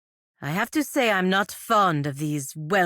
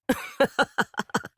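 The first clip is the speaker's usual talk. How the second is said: a short breathy laugh, pitched up; 9 semitones higher than usual. Acted. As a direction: laugh it off lightly. Companion laughs at a remark.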